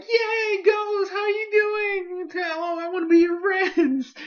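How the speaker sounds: very high, gushing squeal with drawn-out words; Bonnie. Acted. Girl greeting a ghost she wants to befriend, high delighted squeal. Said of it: "Bonnie's like".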